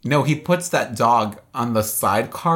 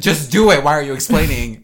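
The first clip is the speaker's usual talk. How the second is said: loud, higher exasperated retort; impatient outburst; 4 semitones higher, 7 dB louder than usual. Retold or imitated. Viewer heckling a stalling movie character, loud and impatient. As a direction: yell at them to get on with it.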